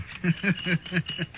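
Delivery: a short, high, pleased laugh; amused laugh. Acted. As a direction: laugh off the insult like it's a compliment. Outlaw amused by insult.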